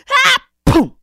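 high loud shriek then boom; angry scream and boom. Acted. The furious imp screams as his foot breaks through. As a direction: shriek and stomp.

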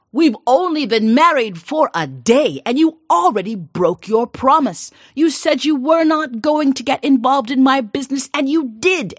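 loud, high furious accusation. Acted. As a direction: rage at a wife who broke her word. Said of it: "he was furious".

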